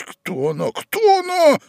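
insistent, escalating demand; urgent questioning. A narrator's reading. Young man gripping Ivan's hands, urgently asking who. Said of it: "прошептал молодой человек".

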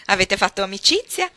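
a loud laugh, then a laughing question; bursting into laughter. A narrator's reading. Countess turns to the marchesa and Archer, laughing out loud. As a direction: laugh off the tears and tease them. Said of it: "scoppiò a ridere".

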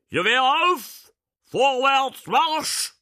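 very high, full-force drill order; shouted military command. A narrator's reading. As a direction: bellow orders to troops outside. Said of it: "rief er". (lauthals gebrüllter Kasernenhofbefehl)

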